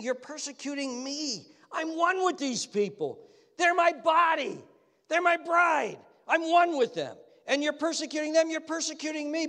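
high, loud, impassioned declaration; Jesus. Acted. Jesus defending his people to Saul, impassioned and high. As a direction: proclaim it insistently, high and forceful. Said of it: "And Jesus is saying".

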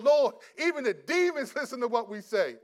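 loud, high, bursting to report amazing news; acted-out giddy excitement; can't wait to tell the Lord; excited exclamation. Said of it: "Was excited. They came back, couldn't wait".

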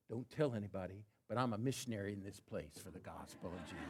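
low, hushed retelling of a confidence; retold confiding. Store clerk quietly confides in a customer about her mission, retold confiding.

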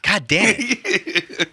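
high, slow, laughing through it; laughing frustration. Real, not acted. Stumbling over a poem line, cursing while laughing. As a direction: laugh at your own stumble.

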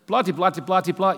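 playful blah-de-blah; mock filler. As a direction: breezily skip the reading. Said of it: "skipping the rest playfully".

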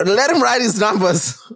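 mock demand, high and emphatic; Rio Ferdinand. Football fan imitating a pundit's viral rant, insistent. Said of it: "that Rio Ferdinand video of".

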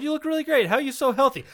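high, friendly interviewer voice; admiring stranger. Pretend. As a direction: sweetly flatter the old man.

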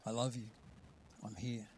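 soft, low reassurance; hushed, intimate comfort; gentle voice of God. Acted. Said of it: "The voice of God speaking".

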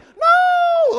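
very high, loud cry of No!; mock scream. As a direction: screech No! as the stabbed mussel. Imagined mussel screaming.

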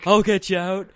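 loud dopey cartoon cat; lightly voiced big friendly cat; Tiger's voice. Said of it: "It's fun to do Tiger's voice".

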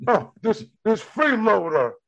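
high mock complaint about a freeloader. Comic riffs on the snorting dog, mock complaint. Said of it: "let me do Andrew".

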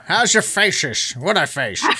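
"faces" slurred into "fayshes"; mock slurring. Host teasing guest's slip, "faces" slurred as "fayshes". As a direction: drag out a mock-drunk sh in "faces".

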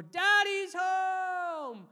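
put-on high, long drawn-out child's yell; Joshua. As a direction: imitate a small boy yelling a welcome. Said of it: "he'll yell the moment".

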